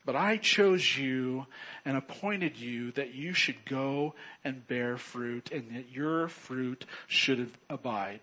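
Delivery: ordinary talk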